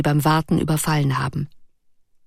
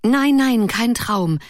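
higher, quick eager denial; 6 semitones higher, slower than usual. Acted. Ruth eagerly insists to Erik that it is no dream. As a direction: brush the question aside eagerly. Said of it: "sagte sie eifrig".

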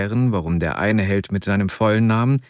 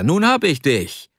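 higher gloating call, swooping up on hab; triumphant call; 6 semitones higher than usual. A narrator's reading. Arkadi pinning his friend on the bed, higher triumphant call. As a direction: crow it out over the captured friend. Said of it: "rief er".

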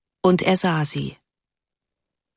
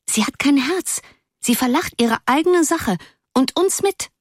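much higher, indignant schoolgirl; indignant girl; 8 semitones higher than usual. A narrator's reading. Offended girl accusing the laughing friend, high and indignant. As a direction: accuse her, hurt and sharp. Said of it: "behauptete sogar".

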